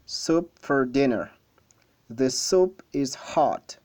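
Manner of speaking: slow, careful read-aloud for learners; unhurried textbook reading voice. Shown as a demonstration. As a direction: read each short sentence slowly and clearly. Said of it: "de la plus, euh, lente des manières".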